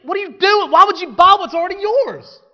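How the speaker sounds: high, loud disbelieving outcry; incredulous shout. Acted. Preacher mocking the buyer.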